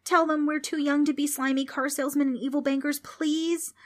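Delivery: put-on high-pitched little girl begging; pleading cry. A narrator's reading. Trix pleads they're too young, crying out. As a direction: beg urgently and childishly. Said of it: "Trix cried".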